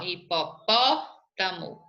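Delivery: "hipopótamo" slowly, holding PÓ, then a break before TAMO; stress on PÓ. Shown as a demonstration. Teacher pointing out the antepenultimate syllable, "hipopótamo" broken after PÓ. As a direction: stop after a long PÓ in "hipopótamo". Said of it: "antepenúltima".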